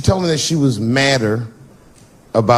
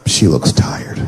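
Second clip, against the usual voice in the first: whisper; 9 semitones lower, slower than usual. Acted. Inner thought at night.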